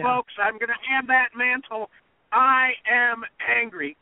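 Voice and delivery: high retold declaration, last words slow and emphatic; Trump's defiant retort. Fan retelling Trump's debate answer, emphatically defiant. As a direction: imitate a candidate proudly owning the anger.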